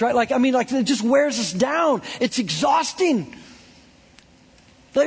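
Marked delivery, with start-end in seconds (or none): none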